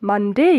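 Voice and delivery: "মানডে" rising onto a full "DEY"; correct pronunciation. Shown as a demonstration. Teacher modelling for learners, careful correct pronunciation. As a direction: lift the end of "মানডে" into an ey glide. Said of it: "Repeat after me".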